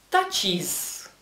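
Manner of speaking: "touches" with an added "-iz" syllable; terminación en «is». Shown as a demonstration. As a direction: say "touches" slowly as two syllables, TATCH-iz. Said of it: "añadir de nuevo is". («touches» pronunciado «tach-is»)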